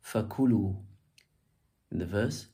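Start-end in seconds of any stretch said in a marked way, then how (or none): 0.1-0.8 "Fakulu" with the ku stressed, falling after; "Fakulu", peak on ku then drop. Shown as a demonstration. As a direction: lean on the middle syllable of "Fakulu". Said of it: "emphasize the start of the command".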